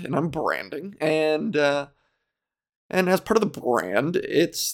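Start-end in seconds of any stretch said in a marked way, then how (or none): none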